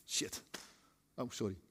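a quiet slipped curse, then a hushed sorry; muttered oops. Pretend. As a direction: let it slip under your breath. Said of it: "gezegd hadden van".

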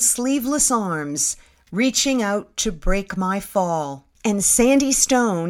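ordinary delivery

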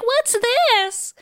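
high-pitched, clueless question; mock bewilderment. Voices Moira without her memories.

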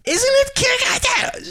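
squeaky falsetto question; high voice. Shown as a demonstration. Podcaster mocking her own high voice. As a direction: jump way up into a high voice. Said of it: "when I do my high voice".

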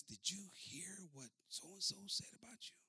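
secretive gossiping whisper. Retold or imitated. Secretary whispering gossip to the pastor. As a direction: hush it like passing a secret. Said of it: "And she says".

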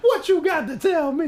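high, slow mock demand; impatient victim. Prank victim pressing the caller, mock impatient. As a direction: stretch out the impatient question.

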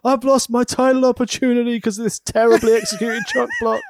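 high, hammed-up whine; mock crying wrestler. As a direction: overact a wrestler sobbing on the mat. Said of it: "crying on the floor".

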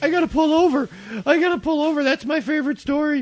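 high, loud, hammed-up hysterics; crying-laughing friend imitation. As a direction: squeal it like a friend losing it. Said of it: "She's, like, crying".

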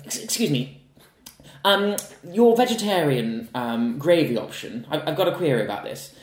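high, loud, slowed-down mock customer voice; lightly put-on fussy diner raising a complaint. Imitating a pub customer querying the gravy, mock fussy. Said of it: "she's like".

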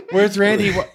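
high, loud, panicked woman impression; Elizabeth. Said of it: "She's like".

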